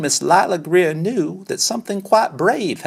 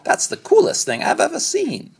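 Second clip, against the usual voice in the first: much higher, excited mom voice; mother exclaiming; 12 semitones higher than usual. Acted. Mother praising her toddler's diaper tower, high and thrilled. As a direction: gush over it like a proud mom. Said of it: "his mother exclaimed".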